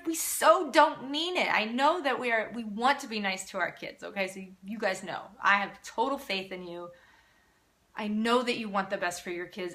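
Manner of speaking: usual speaking voice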